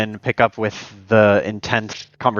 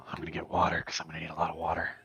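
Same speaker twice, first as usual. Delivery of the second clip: whispering; 5 semitones lower, 15 dB quieter, much faster than usual. Real, not acted. Whispered aside.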